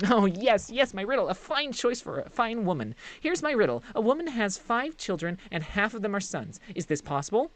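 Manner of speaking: high, quick, fawning sprite voice; a giddy small servant creature gushing about Master's things; eager little creature. Acted. Reezy the creature greets Hestia, high and eager.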